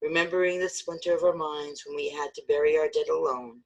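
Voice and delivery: usual speaking voice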